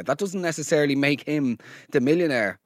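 usual speaking voice

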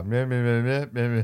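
monotone "meh meh" babble; wordless mumbled recital; mock droning. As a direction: imitate dull reading off a notepad. Mimics comics reading from a notepad.

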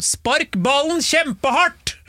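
high, slow drawn-out cheering from the stands; mock stadium yell. Silly fan yelling at the players from the stands. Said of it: "jeg pleide å rope sånn drithøyt sånn".

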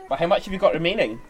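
bright high classroom voice; teacher voice. Acted. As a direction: talk up to young pupils. Teacher questioning pupils.

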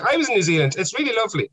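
high, sing-out older-woman impression; imitating chatty heckler. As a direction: put on the oblivious heckler, pitched well up. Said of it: "she was like".

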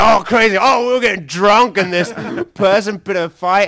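high, loud hammed-up enthusiasm; mock excitement. Musician parodying wild rock and roll stories, mock-excited. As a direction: imitate someone raving, high and loud.

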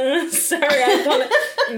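giggling apology; laughing. Real, not acted. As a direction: say it through giggles.

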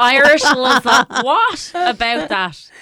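slow, loud, disbelieving laugh through the words; mock the name while cracking up; incredulous laughing repeat. Real, not acted. Repeating the clip's dish name to her co-hosts, laughing in disbelief.

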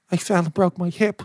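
lightly voiced mother; retold mother's voice. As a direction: imitate mum owning up to her son. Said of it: "she's like".

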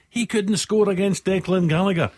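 high-pitched mock outburst, well above normal talk; imitated commentator's exclamation. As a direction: exclaim it high, quoting someone's stock line. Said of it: "you say".

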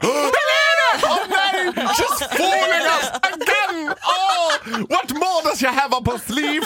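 gasping crowd. Retold or imitated. Audience watching the singer leap from the roof, awed high cries. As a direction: squeal like a stunned fan. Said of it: "Så hela publiken bara".

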